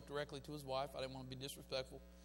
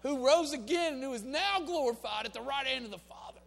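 preaching at full force; 12 semitones higher, 11 dB louder than usual. Acted. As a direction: drive each line home to the crowd. Climactic preaching of Romans 8.

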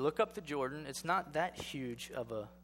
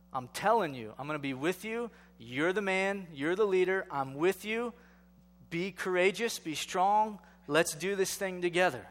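louder, higher, fired-up sermon voice; rally him like a coach from the pulpit; rousing preaching; 4 semitones higher, 5 dB louder than usual. Acted. Preaching God's encouragement.

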